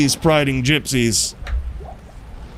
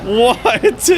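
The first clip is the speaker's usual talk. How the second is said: laughing disbelief; 9 semitones higher than usual. Real, not acted. Disbelief at the drop.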